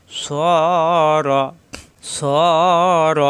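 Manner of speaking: long rounded "স", short "র"; one-alif madd. Shown as a demonstration. Teacher drills joined word, held "স" then short "র". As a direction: draw out "স" and cut to a brief "র". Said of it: "এক আলিফ টান".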